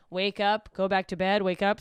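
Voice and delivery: ordinary delivery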